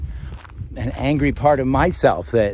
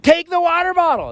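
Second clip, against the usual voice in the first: high mock order; imitating the woman. Woman ordering the girl, high mock order. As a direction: pitch way up and bark it once. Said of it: "she said".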